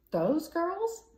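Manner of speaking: high, loud appalled question; the Admiral recoils at the idea; recoiling in shock. A narrator's reading. Old Admiral to Miss Brooke, shocked high and loud. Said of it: "The Admiral recoiled".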